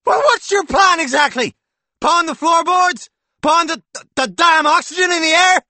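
high, loud scornful yell; sarcastic shouting. A narrator's reading. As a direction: hurl the sarcasm. Husband shouting in quarrel.

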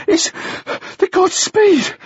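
put-on high, winded boy; barely managing words. A narrator's reading. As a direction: squeeze it out between gasps. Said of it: "the boy managed".

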